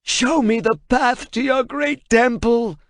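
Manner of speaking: high, slow, pained and frightened cry. Acted. Translator reading under torture, pained cry. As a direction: wrench the words out in fear. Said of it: "he cried out in pain and fright".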